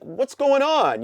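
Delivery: high, slow baffled question; high drawn-out what's going on; puzzled question. Retold or imitated. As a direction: demand to know what's happening. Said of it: "he's like".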